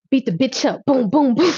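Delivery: high, loud hyped punch noises; mock beatdown. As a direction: cheer on the fight, hit each boom. Replays reading scene, punches.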